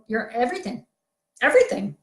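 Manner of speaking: ordinary talk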